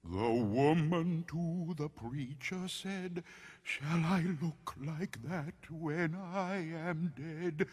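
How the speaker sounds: creepy campfire poem with drawn-out oohs; spooky poem recital. A narrator's reading. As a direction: recite a ghost rhyme to give chills.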